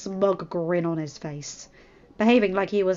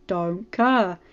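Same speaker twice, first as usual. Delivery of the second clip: grudging, deadpan reply; grumpy Branch voice; 5 dB louder than usual. Acted. Branch refusing.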